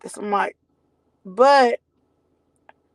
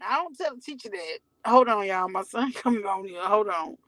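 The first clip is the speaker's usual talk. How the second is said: ordinary talk, nothing marked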